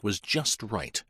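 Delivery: ordinary delivery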